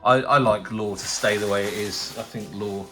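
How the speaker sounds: normal talking voice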